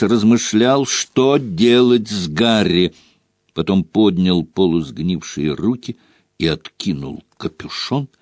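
usual speaking voice

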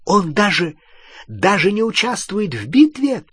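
high-pitched, incredulous protest; indignant question. A narrator's reading. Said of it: "возмущённо спросила Гермиона". (возмущённый, недоверчивый вопрос высоким голосом)

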